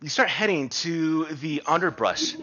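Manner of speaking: normal talking voice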